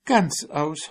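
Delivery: a hint of a frightened mouse; mouse blurting. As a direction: it slips out.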